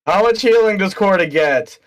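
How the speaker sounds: high, loud, wowed; amazed. Acted. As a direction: gasp it out, thrilled. Character amazed.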